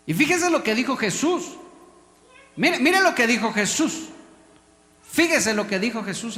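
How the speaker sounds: loud, high preacher's call; urgent sermon shout; preaching call. Acted. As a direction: rouse them to listen. Preacher calls attention.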